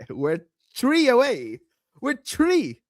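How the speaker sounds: mock Irish "three" said like "tree"; playful Irish accent impression. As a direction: imitate her Irish way of saying three. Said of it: "That's how she says three".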